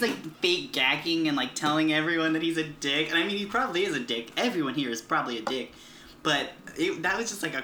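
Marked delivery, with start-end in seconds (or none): none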